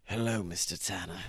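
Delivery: low, quiet villain greeting; creepy low welcome; sinister low voice. Acted. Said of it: "The sickening voice sends chills".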